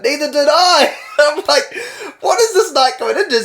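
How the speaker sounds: high, loud disbelief; incredulous. Retold or imitated. Narrator reacting to the night's twists, incredulous. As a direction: squeak it in disbelief.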